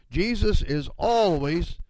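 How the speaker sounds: always drawn out slowly and stressed. Shown as a demonstration. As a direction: lean on the word always, slow it down. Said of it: "I emphasize that word always".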